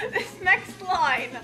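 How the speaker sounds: usual speaking voice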